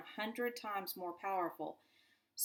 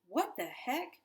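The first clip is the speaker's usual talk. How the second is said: a higher, sharp, annoyed outburst; angry voice; 5 semitones higher than usual. A narrator's reading. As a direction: startle the kids with irritation. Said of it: "an angry voice cut the air".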